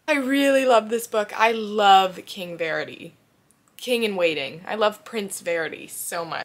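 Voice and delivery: loud, bursting with love for the book; gushing excitement. Real, not acted. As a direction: shout your love for the characters. Gushing about loving the book.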